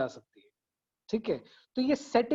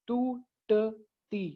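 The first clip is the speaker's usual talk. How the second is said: syllable by syllable; 4 dB quieter, much slower than usual. Shown as a demonstration. Teacher repeats the syllable count for students, टूटती slowly split apart. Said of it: "syllables वो होते हैं जहां पर आवाज टूटती है".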